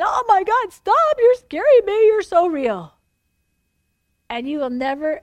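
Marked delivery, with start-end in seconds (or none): 0.0-2.9 higher, louder cry of awe; overwhelmed crying out. Pretend. Imagined believer slain in the Spirit, crying out loud in awe. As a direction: cry out as if God's presence scares you. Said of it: "down, crying".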